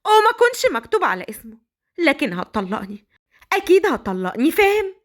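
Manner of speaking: high, firm defiance; defiant young bride. Acted. Forced bride telling Badr he'll divorce her, high and defiant. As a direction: stand up to him.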